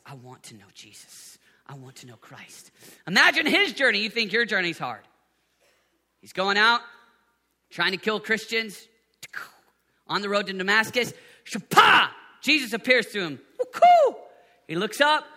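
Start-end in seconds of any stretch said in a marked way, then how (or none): 0.0-2.7 much softer, lower devoted vow; hushed devotion. Acted. Preacher voicing Paul's longing for Christ, hushed. Quiet confession after preaching. 11.4-14.2 loud, high burst of a mock flash; sound effect shout. Preacher acting out Jesus appearing, loud sound effect. Dramatic Damascus road moment.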